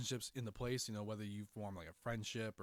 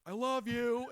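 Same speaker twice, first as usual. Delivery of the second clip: high, loud mock declaration; teasing shout; 10 semitones higher, 6 dB louder, slower than usual. Freaking out his coworker from afar, teasing shout. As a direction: holler it to freak a friend out. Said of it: "just to freak you out".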